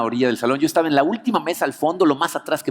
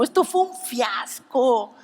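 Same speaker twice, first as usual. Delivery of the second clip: mock despair; 10 semitones higher, much slower than usual. Repeats fiasco line, acted.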